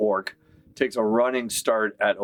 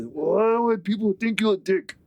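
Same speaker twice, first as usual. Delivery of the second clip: much higher dopey orc; 12 semitones higher than usual. Acted. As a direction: reassure a friend like a big simple brute. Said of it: "The other one says".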